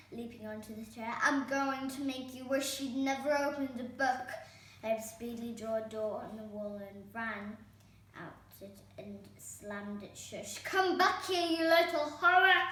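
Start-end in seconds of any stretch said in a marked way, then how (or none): 1.2-4.3 louder, higher threatening stepmother; furious threat. A narrator's reading. Stepmother leaps on the chair threatening Herb. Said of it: "she screamed". 10.6-12.6 put-on high, loud screech after a fleeing boy. A narrator's reading. Angry stepmother screeches after the escaping Herb. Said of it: "she screeched".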